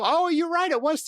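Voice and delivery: host realizes the guest is right about San Diego; sudden realization. Real, not acted. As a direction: caught out, admitting it brightly. Realizing he misremembered the city.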